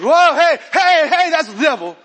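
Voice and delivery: high, loud startled hey hey; shocked gasp. Retold or imitated. Congregant shouting at the condom news in alarm. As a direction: recoil like you saw the devil. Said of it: "Look at you, you're like".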